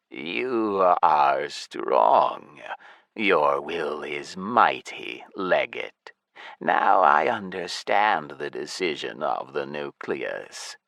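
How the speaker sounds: low, slow undead voice; lich voice. Acted. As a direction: speak like a cold ancient lich. Lich speaks slowly.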